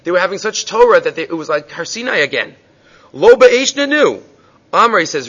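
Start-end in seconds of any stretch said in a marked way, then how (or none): 3.1-4.2 excited. Retold or imitated. Father reacting to the fire.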